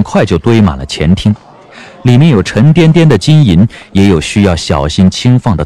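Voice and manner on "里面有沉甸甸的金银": ordinary talk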